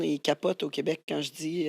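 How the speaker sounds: ordinary talk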